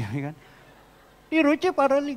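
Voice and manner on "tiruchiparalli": "Tiruchiparalli" said in a high mock voice; mimicked place name. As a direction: exaggerate "Tiruchiparalli", pitched well up. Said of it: "gitu kan, saudara".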